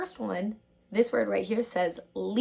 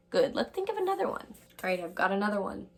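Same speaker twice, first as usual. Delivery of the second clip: ordinary talk, nothing marked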